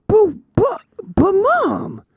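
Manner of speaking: put-on high kid voice stammering; stammering little boy. Son protesting to his mom, high stammering kid voice. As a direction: sputter like a caught kid.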